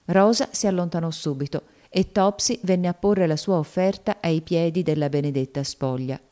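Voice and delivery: ordinary talk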